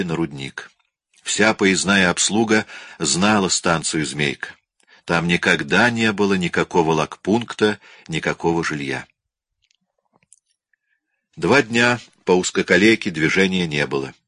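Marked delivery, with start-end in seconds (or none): none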